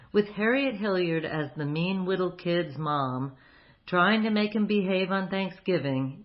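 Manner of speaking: ordinary talk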